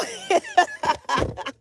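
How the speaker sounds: high peal of laughter; high cackle. Real, not acted. Amused by her friend's comments, cackling. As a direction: cackle at your friend's nerve.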